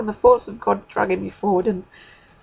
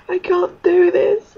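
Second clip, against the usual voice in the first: despairing; 5 semitones higher, 5 dB louder, slower than usual. Retold or imitated. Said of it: "I was like".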